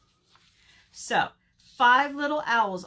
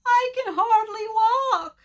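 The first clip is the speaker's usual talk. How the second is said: very high, loud wail; hammed-up crying bird voice; 14 semitones higher, 8 dB louder than usual. A narrator's reading. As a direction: bawl it high like a hurt little one. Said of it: "wailed Bird".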